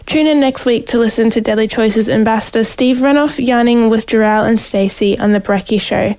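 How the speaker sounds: ordinary delivery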